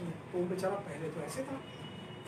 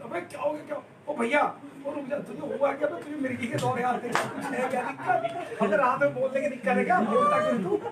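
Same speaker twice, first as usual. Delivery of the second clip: calling out; 5 semitones higher, 4 dB louder than usual. Retold or imitated. Shaking scared friend, calling out.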